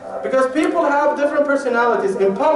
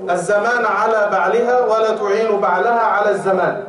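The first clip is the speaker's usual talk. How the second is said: fast, rhythmic recital of a sacred text; rapid sing-song Arabic hadith recital; Arabic recitation; much faster than usual. Acted. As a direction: rattle off the memorised Arabic saying in one breath.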